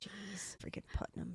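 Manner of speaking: hushed muttering curse; whispered aside, annoyed; whispered grumble. Real, not acted. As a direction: grumble it under your breath. Muttered aside about husband's family.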